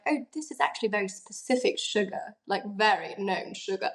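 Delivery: put-on lightly done posh British accent; a hint of British accent, a touch snooty; child imitating the British at a tea party, light British accent. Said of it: "And then, like, the British".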